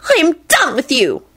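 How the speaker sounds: high-pitched final rejection; shrieking declaration; enraged screech. A narrator's reading. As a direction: screech the break-up line. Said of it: "she screeched".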